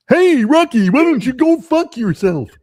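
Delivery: high, loud goofy cartoon moose; Bullwinkle voice. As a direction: go big and dopey like a cartoon moose. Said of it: "Do the old Bullwinkle".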